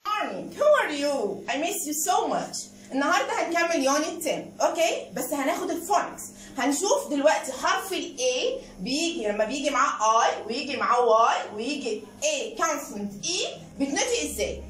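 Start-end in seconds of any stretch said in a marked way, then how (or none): none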